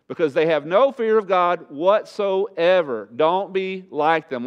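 high, loud and driving pulpit fire; preaching at full force. Acted. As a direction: preach it hard, slowing to pound each line.

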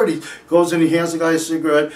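ordinary talk, nothing marked

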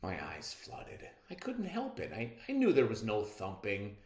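ordinary delivery

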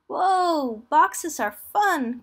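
high, loud, drawn-out delight; excited cry. A narrator's reading. Roo delighted by the moving boxes, excited cry. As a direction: cry out with glee, stretching the words. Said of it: "cried Roo".

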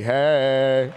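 long, loud "hey"; celebratory cheer. Real, not acted. Speaker to congregation after twelve years of marriage, celebratory cheer. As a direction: cheer it out, drawn out.

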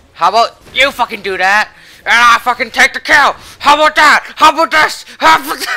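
high, very loud gloating yells; screamed taunting. Real, not acted. Gamer to opponent after taking the kill, screamed taunting. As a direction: shriek taunts at the beaten opponent.

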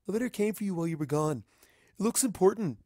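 a hint of a young dragon assistant, quick and eager; Spike voice. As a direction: blurt the news quickly.

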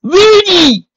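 high, loud, drawn-out call. A narrator's reading. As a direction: shout the name across open air. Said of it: "called to the cold air".

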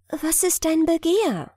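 high, ringing mermaid voice; ringing crystal chime voice. A narrator's reading. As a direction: ask it like a chiming bell. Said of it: "sagte sie mit klingender Stimme".

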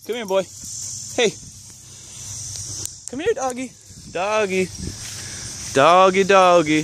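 high coaxing call; calling a dog. Real, not acted.

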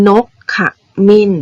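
นกข มิ้น read in separate syllables. Shown as a demonstration. Teacher showing pupils how a bird's name reads, syllable by syllable. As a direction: sound out นกข มิ้น, pausing before มิ้น. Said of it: "เวลาที่เราเขียนคำอ่าน เด็กๆสังเกตคำนี้ให้ดีนะ".

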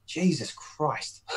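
slow, hushed "Jesus Christ"; muttered disbelief. Retold or imitated. Host recalling his reaction to a mate's casual attitude, hushed disbelief. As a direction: draw it out softly in stunned disbelief. Retelling own reaction to calm friend.

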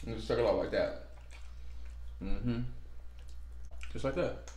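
lips on shell, sucking; talking mid-slurp with food; while sucking food. Real, not acted. Seen 1: Both hold food to lips throughout; speaker unclear, hands hide mouths.

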